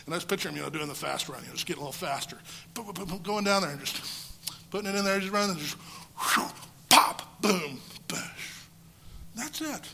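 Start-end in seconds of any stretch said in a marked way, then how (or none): none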